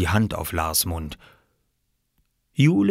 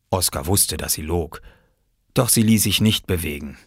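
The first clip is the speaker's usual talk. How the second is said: ordinary delivery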